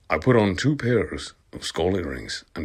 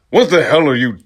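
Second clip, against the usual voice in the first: a higher, louder mock outburst, slowing on the insult; angry Dumbledore; 4 semitones higher, 6 dB louder than usual. Dumbledore catching the couple in the forest, hammed-up fury. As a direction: bellow it like a scandalised headmaster. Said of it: "It was Dumbledore".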